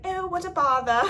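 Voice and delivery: high, loud mock British accent. Vlogger imitating the British baking show contestants, mock British accent. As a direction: put on a playful British accent. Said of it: "imagine it in a British accent".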